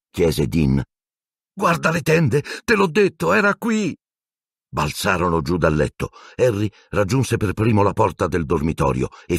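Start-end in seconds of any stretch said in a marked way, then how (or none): 1.5-4.0 high, urgent frightened voice; frightened boy. Acted. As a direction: insist in panic. Ron insists, frightened.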